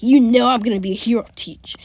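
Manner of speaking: loud, fast boast to the teacher; cocky Bakugo. Retold or imitated. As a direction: brag it right at the teacher. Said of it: "Bak would be like".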